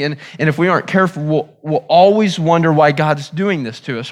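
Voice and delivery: ordinary talk, nothing marked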